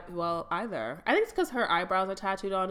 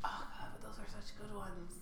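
whispered dismay; 6 semitones higher, 13 dB quieter than usual. Real, not acted. Dismayed aside to friend.

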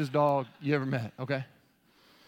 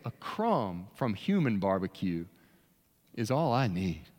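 slow, lower humble dog voice; lightly voiced humble dog; voicing the dog; 9 semitones lower than usual. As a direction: play the dog begging humbly. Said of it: "'Cause Lottie's saying".

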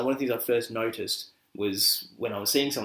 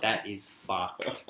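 put-on urban Aussie accent; 3 semitones lower, 4 dB quieter, much slower than usual. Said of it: "I'm gonna do my best urban Oz accent".

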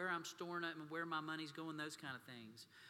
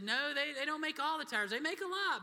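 much higher and louder, straining patience; pitched-up exasperated explanation; exasperated dad; 11 semitones higher, 10 dB louder than usual. Retold or imitated. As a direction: answer like a dad at the end of his rope. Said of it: "and I said".

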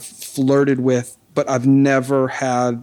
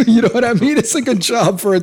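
laughing; 10 semitones higher than usual. Real, not acted. Laughing at his own story.

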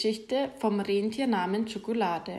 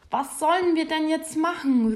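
higher, flustered call; a hint of sheepish panic; embarrassed call; 6 semitones higher than usual. A narrator's reading. Reindeer calling down to Santa, mildly flustered. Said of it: "ruft Schokolade, dem es jetzt doch etwas peinlich ist".

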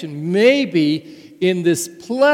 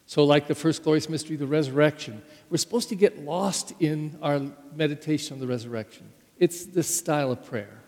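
ordinary talk

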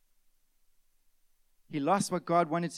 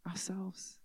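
whisper; 3 semitones higher, 10 dB quieter than usual. Acted. Whispered emphasis.

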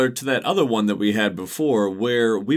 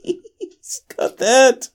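laughing; much slower than usual. Real, not acted. Laughing after slip of tongue.

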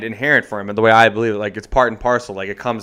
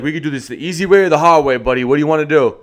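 a lightly voiced guard, a bit louder; tough security guard; 3 semitones higher, 5 dB louder than usual. Security guard menacing the student, lightly voiced tough guy. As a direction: play the heavy offering easy or hard way. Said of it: "he's like".

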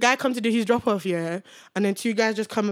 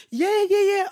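high-pitched, quick mock yeses; retold eager agreement; 10 semitones higher, 3 dB louder than usual. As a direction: play up being far too keen. Acts out own eager agreement.

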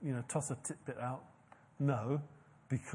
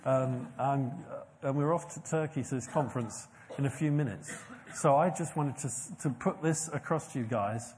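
ordinary talk

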